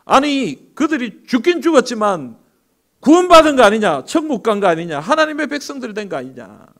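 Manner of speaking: high, loud furious Satan; Satan snapping at his second servant; angry outburst. Acted. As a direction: blow up at the servant. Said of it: "화를 버럭 냈습니다".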